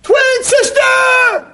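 high, long-drawn full yell; huge high-pitched holler; scream. Acted. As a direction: scream it out, stretching every syllable. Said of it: "he runs out and he screams".

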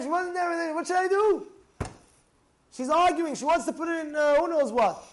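high, loud exasperated complaint; agitated pleading. Retold or imitated. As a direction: plead at the top of your voice. Acted distressed congregant pleading with rabbi.

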